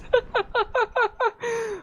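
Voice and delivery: high, breathy, embarrassed giggle; high laugh. Real, not acted. Caster laughs at own voice crack.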